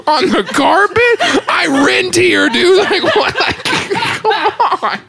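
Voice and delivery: high, pleading laughing whine; real helpless, high-pitched laughing protest; laughing to tears. Real, not acted. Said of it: "I've got my tears on the carpet".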